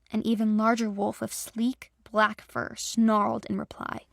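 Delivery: ordinary delivery, nothing marked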